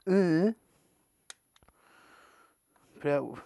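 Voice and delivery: ordinary delivery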